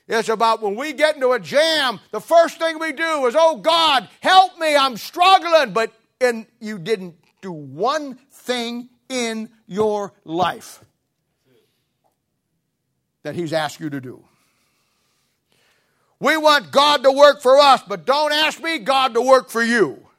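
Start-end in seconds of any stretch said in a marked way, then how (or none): 3.4-5.7 high, loud, dragged-out plea to God; mock panicked prayer. Preacher mocking believers in a jam, high loud plea. 16.2-20.1 rising to a loud, high preacher's cry; preaching at full force. Real, not acted. Preacher to congregation, loud high proclamation.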